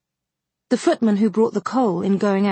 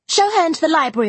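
fast, high, louder interruption; cutting in; 9 semitones higher, 4 dB louder, faster than usual. A narrator's reading. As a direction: brush her mother aside. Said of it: "cut in the angel girl".

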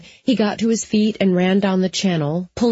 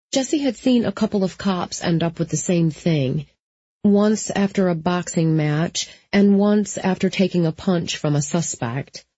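plain reading voice

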